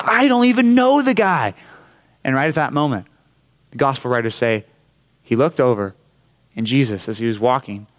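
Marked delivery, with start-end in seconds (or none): 0.0-1.5 much higher, louder angry outburst; cursing denial. Retold or imitated. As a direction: spit out the denial while cursing. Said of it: "He says".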